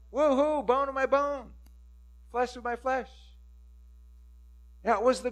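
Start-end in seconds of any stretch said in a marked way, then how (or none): 0.1-3.1 high, loud whoop then exultant words; joyful cheer. Acted. The couple rejoicing over each other, high and loud. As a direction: celebrate it, full of delight. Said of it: "They said".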